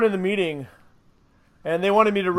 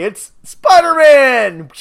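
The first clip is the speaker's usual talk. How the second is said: big shouted sponsor reveal; loud announcement; 5 semitones higher, 10 dB louder, much slower than usual. Acted. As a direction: hype it up like an ad.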